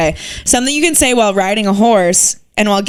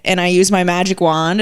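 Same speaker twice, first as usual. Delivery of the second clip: usual speaking voice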